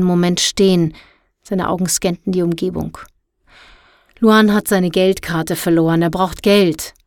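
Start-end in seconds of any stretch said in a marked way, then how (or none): none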